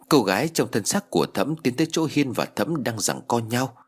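usual speaking voice

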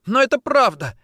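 high, loud cry of protest; desperate insistence. A narrator's reading. Woman disbelieved by lover, desperate insistence. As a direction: insist urgently.

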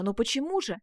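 loud, puzzled outburst; crying out. A narrator's reading. Youth questioning the duchess, startled outcry. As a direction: exclaim it as an urgent question. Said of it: "вскричал юноша".

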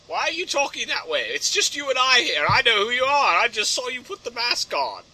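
high, questioning butler voice; Alfred. Acted. Butler questions hero's needless disguised voice, high protest. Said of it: "After, he's like".

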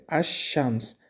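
الشمس said ash-shams, lam silent, sh doubled; sun letter assimilation. Shown as a demonstration. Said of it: "لام نخوندیم".

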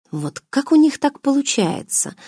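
puzzled wonder, pitched high; high-pitched, understated astonishment; surprised. A narrator's reading. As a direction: ask it high and softly amazed. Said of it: "удивилась Даша".

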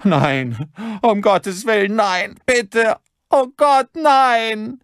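shrieked, panicking plea; terrified begging. A narrator's reading. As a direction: beg for your life, very high and loud. Victim begs under gunpoint.

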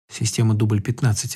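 barely voiced, soft lips only; mouthed quietly. Igor mouthing the code word softly. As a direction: say it almost silently with the lips. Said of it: "одними губами сказал Игорь".

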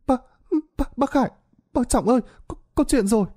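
put-on high, breathless child voices stumbling over the words; children stuttering in panic; stammering panicked children. A narrator's reading. Frightened kids stammer the bad news to the elders. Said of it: "miệng lắp bắp".

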